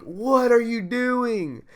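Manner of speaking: loud, high, drawn-out disbelief; incredulous. Retold or imitated. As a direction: stretch it out in baffled, high-pitched outrage. Retold incredulous reply to teacher.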